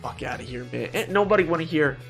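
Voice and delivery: ordinary delivery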